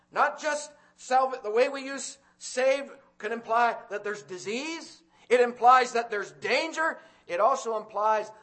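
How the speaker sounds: loud, high, urgent preaching; preaching at full force. Acted. Preacher warning the congregation, loud and high. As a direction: thunder the warning at the sinners.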